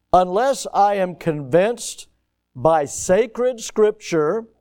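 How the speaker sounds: loud, slow, emphatic declamation; declaimed historic speech. Acted. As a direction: proclaim it weightily, pausing between phrases. Declaims Luther's refusal to recant.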